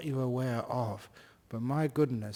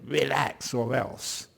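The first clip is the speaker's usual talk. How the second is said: stern command; slower than usual. Retold or imitated. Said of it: "You can't tell it".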